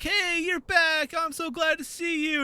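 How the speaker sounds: high, drawn-out glad welcome; gushing mock greeting. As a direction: imitate an overjoyed greeter, slow and high. Sword makers greeting the returning fighters.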